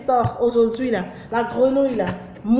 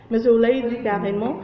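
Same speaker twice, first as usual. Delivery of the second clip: normal talking voice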